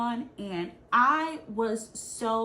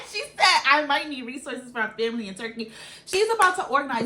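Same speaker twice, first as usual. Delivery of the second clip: laughing; 5 semitones higher, 10 dB louder, faster than usual. Real, not acted. Laughing at the memory.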